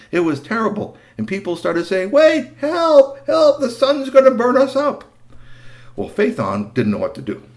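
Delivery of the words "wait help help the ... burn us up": high, loud frightened calling; panicked cry for help. Acted. People on earth crying for help as fields burn, frightened. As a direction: plead for rescue in alarm.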